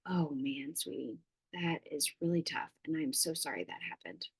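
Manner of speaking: put-on soft, hushed comfort for a hurt child; empathizing. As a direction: whisper it tenderly, like consoling. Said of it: "I'm just empathizing".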